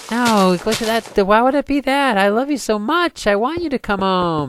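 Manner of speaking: hammed-up high, loud protest; gushing mock wife. Husband imagining what his wife could have said, gushing. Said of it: "she coulda said".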